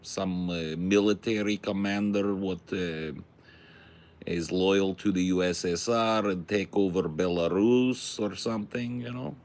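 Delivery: put-on broken-English Russian accent, lightly done; mild mock Russian accent with dropped articles. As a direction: play a Russian pitching a movie plot, in accent. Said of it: "Is my Russian accent so good".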